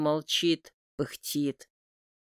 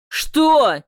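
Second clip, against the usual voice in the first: alarmed what; 9 semitones higher, 10 dB louder than usual. A narrator's reading. Brother finds crying women.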